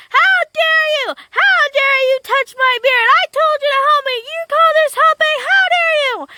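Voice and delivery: high, loud, outraged tirade; enraged little man. A narrator's reading. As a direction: shriller outburst from a red-faced little man. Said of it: "he got so angry, his face turned red".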